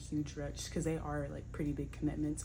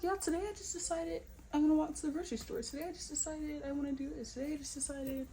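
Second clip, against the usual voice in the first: high, fast, hyped-up voice; hammed-up bubbly announcement; mock excited; 9 semitones higher, faster than usual. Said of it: "I'm like".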